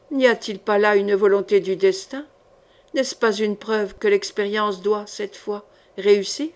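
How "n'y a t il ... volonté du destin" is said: usual speaking voice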